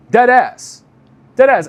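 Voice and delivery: high, loud emphatic declaration; emphatic insistence. Real, not acted. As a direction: swear it's true, loud and high.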